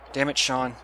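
ordinary talk, nothing marked

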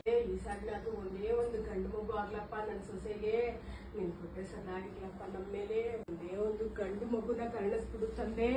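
soft, pleading prayer to god; murmured prayer for a boy; hushed prayer. Acted. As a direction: beg god quietly for a grandson. Mother-in-law prays to god.

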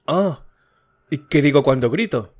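high, frightened cry then a shaky question; terrified. A narrator's reading. Frightened wife asks what she screams at night, terrified. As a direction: gasp it out in sudden terror. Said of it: "El terror dilataba sus ojos".

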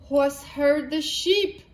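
usual speaking voice

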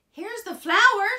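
put-on high, loud boyish cry; excited shout. A narrator's reading. Jack announces the flour to his mother, excited shout. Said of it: "shouted Jack".

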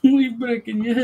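high-pitched mock Italian echo; copying the Italian voice. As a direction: lighter imitation of a cartoon Italian. Listener copies the cartoon voice.

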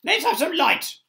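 high, loud demand; shouting centipede. A narrator's reading. Centipede calling for light in the dark peach, shouting. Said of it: "shouted the centipede".

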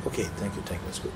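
soft unvoiced whisper of thanks. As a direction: breathe the thanks almost silently. Friend's quiet reply, whispered.

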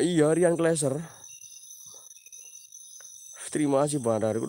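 usual speaking voice

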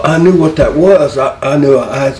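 normal talking voice

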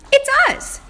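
high, bright praise; excited. Acted. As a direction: cheer the answer, high and loud. Teacher praises the answer.

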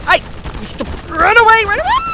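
high, loud cry to flee; panicked cry. Real, not acted. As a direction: yell it out in a panic. Fleeing danger in the game.

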